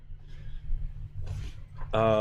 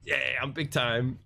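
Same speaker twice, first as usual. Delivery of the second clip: mock bragging; 4 dB quieter, slower than usual. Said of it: "my bragging of like".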